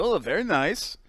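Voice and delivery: high, soft put-on foreign comic voice; Borat. Host showing his co-host the real Borat, high comic impression. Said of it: "Borat's a completely different".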